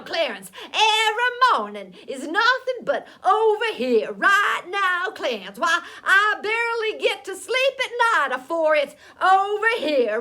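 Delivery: loud, exasperated complaint; fed-up talking mule. A narrator's reading. Mule griping to the boy about every morning, fed up. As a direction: rant at top volume, mocking the holler.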